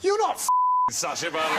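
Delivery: very high-pitched, incredulous local; mock a loudmouth's squawked disbelief; disbelieving pub bloke. Pub bloke refusing to believe him, high-pitched incredulous imitation.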